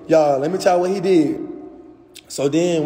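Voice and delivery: ordinary talk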